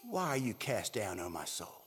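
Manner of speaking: low, hushed self-questioning; talking to himself. Acted. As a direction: drop down and question your own soul. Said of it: "he talks back to himself".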